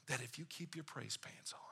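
breathy whispered aside; playful whisper to the crowd. Acted. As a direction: lean in and whisper the joke.

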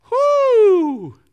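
high, loud drawn-out whoo; shocked whoo. Real, not acted. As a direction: let out a stunned whoo at the burn. Reacting to Jesus calling Peter Satan.

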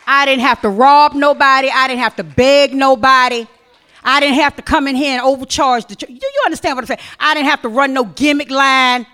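loud, emphatic preaching; preaching at full force. Acted. Preacher insisting to the church, loud and emphatic. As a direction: hammer each line home.

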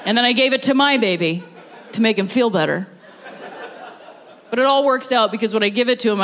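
usual speaking voice

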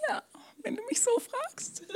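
tiny, very high, soft squeak of a yes; meek reluctant yes. Retold or imitated. Preacher retells giving in to God's request, meek high squeak. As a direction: give in sheepishly in a small high voice.

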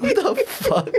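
very high squeaky outraged surprise; squeaked what the heck in disbelief; mock disbelief. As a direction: play it baffled and offended. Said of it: "I'm like".

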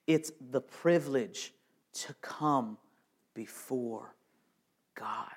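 breathy hush before God; soft sermon whisper. Real, not acted. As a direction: whisper it with reverent awe. Preacher drops to hushed awe.

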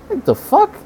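very high, shocked cry; can't believe it; disbelief. Real, not acted. Exasperated outburst at homophobes.

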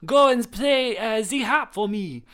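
loud, high mock-French command; French accent. Villain orders the warthog to the harp, high French accent. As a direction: call it out in a hammed-up French accent. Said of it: "in a French accent".

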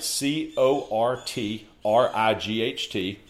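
slow, letter-by-letter spelling. Real, not acted. Guest spells his coach's surname for the host, letter by letter. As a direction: spell it out slowly, each letter separate. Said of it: "Spell, spell his Courtwright".

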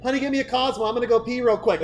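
high, loud girlfriend voice, put on; imitated bar girl. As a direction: play a breezy girl calling to her honey.

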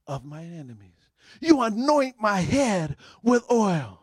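gentle scripture recitation; recited psalm. Acted.

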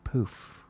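soft, voiceless little "poof"; gentle puff. Retold or imitated. Son showing how gently he hit the ball, soft puff. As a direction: let it out as a tiny breath. Imitates gentle pool shot.

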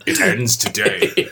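mock ominous villain. Doing a dramatic villain voice.

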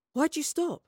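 high-pitched baffled question; genuinely perplexed. A narrator's reading. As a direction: ask in honest puzzlement, pitch up. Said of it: "genuinely perplexed".

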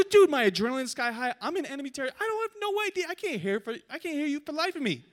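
high-pitched, exasperated comeback; acted flustered protest. Shot player arguing he can't hear in enemy territory, flustered protest. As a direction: sputter back in a high, worked-up voice.

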